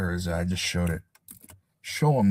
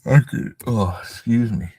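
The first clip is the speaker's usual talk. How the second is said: yawning; 2 semitones higher, 3 dB louder than usual. Real, not acted. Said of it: "Yawning a little bit".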